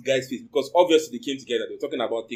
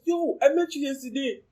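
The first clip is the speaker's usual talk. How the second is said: high, amazed recognition; mild mock surprise at meeting him; surprised; 9 semitones higher than usual. The narrator recognizing the guy from last night, surprised.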